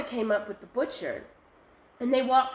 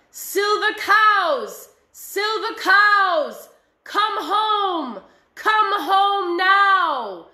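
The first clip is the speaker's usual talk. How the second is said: high, loud, slow commanding call; high, clear, cold with rage; 8 semitones higher, 9 dB louder, much slower than usual. Acted. Lake spirit calling the silver cows home, high and cold with rage. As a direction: summon them home, icy and furious. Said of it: "high, clear voice ... cold with rage".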